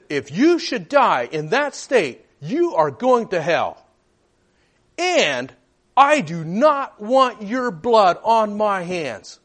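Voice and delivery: 地獄を警告するように、ゆっくり重々しく; solemn warning. Retold or imitated. Young man warns of hell.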